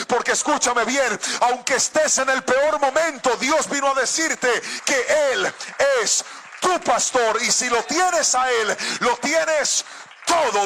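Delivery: rousing sermon, building in intensity; impassioned preaching. Real, not acted. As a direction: drive each repeated phrase home to the congregation. Predica con intensidad creciente. (predicación enérgica que va creciendo)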